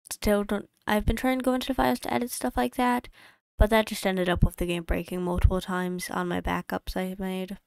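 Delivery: usual speaking voice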